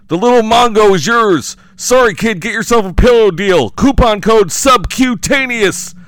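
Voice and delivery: high, loud frantic pitch, slow at end; panicked pitchman voice. Acted. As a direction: sell it in desperate panic. Lindell bargaining in panic.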